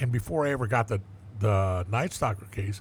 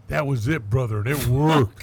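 louder, swelling with pride; proud boast; 7 dB louder than usual. Retold or imitated. The suspect bragging to the detective, loud and proud. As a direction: crow about it, loud and pleased.

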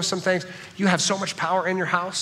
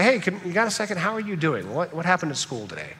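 put-on softer, gentle check-in with a teen; empathetic parent; 5 dB quieter than usual. Shown as a demonstration. Parent gently asking a moody teenager about school, soft and caring. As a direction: ease in softly, like a caring parent. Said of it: "a tone of empathy would not snap back".

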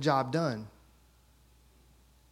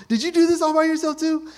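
much higher and louder, fast, thrilled; amazed; 13 semitones higher, 8 dB louder, faster than usual. Retold or imitated. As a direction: gush with proud surprise. Dad amazed again.

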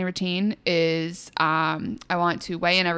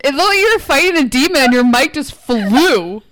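excited; 6 semitones higher, 7 dB louder than usual. Real, not acted. Reacting to mic flinging.

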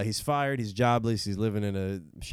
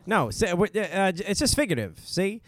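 put-on old-time gangster; 8 semitones higher than usual. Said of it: "be a blind gangster".

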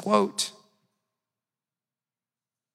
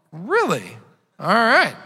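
loud, doubtful mock reply; skeptical neighbor; 5 semitones higher, 9 dB louder than usual. Neighbor answering the invitation, loud and doubtful. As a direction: sound unconvinced and loud. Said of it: "he's like".

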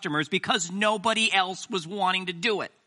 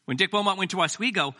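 normal talking voice

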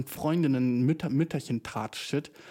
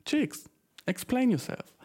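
mock demand; 10 semitones higher, slower than usual. Demanding explanation from girls.

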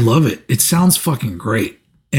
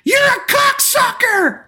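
put-on high, shrill-free piercing child shout, much higher and slow; kid's yell; slower than usual. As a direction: yell it like a little kid in the crowd. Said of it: "he yells".

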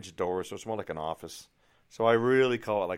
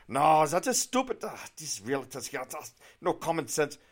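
higher, scornful mock German accent; indignant German realtor; 6 semitones higher, 5 dB louder than usual. German realtor scorning other realtors, indignant imitated accent. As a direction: mimic a German woman fed up with colleagues. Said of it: "tell by the accent she's obviously from Germany".

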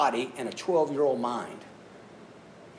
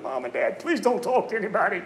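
put-on higher, whiny impatient child begging to leave; whiny kid; 6 semitones higher than usual. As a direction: play a bored kid tugging at his parents. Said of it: "You're like".

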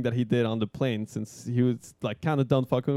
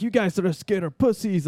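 higher-pitched cocky jeer; pumped-up taunt; 7 semitones higher than usual. Retold or imitated. Pumped first-timer to scared passengers, cocky jeer. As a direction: taunt with mock bravado. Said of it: "I'm all pumped".

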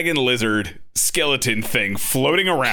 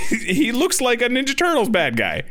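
laughing; 12 semitones higher than usual. Real, not acted. Laughing at the villain.

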